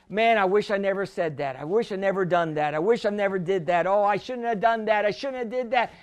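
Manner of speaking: low, soft, hurried self-reproach; mock regretful lament. Someone lamenting yesterday's faults to himself, regretful. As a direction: mumble regrets over and over, quick and low.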